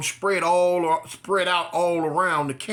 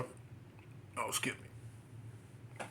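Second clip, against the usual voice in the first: whispered aside; 5 semitones lower, 21 dB quieter, much slower than usual. Real, not acted. Aside, off-mic apology.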